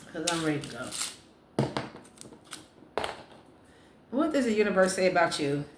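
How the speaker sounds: ordinary talk, nothing marked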